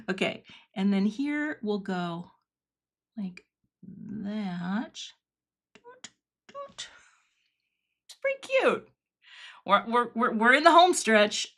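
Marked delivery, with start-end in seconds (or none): 5.7-6.9 mock doot sound. Real, not acted. Playful sound effect while crafting.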